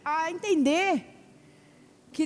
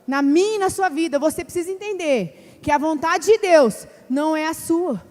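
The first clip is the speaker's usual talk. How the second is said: preaching; 3 semitones lower, 7 dB louder than usual. Acted. Preacher urges the church that God can do it, loud exhortation. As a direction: drive it home to the congregation.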